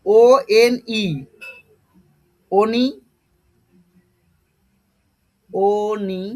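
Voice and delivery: ওএনই spelt slowly, then blended into ওনি "o-nee"; ওনি sounded out slowly, long pauses between tries; syllable demonstration. Shown as a demonstration. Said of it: "এই উচ্চারণটা তোমাদের ঠিকঠাক করতে লাগবে".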